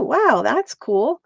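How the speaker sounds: ordinary delivery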